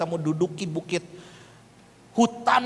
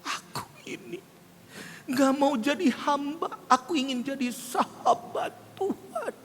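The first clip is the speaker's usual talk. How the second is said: high, choked mock weeping; tearful imitation; 8 semitones higher, 5 dB quieter than usual. Giver hands over the envelope, imitated crying. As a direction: imitate someone sobbing through a vow. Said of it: "Kasih amplop coklat sambil nangis".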